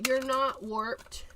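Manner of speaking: ordinary talk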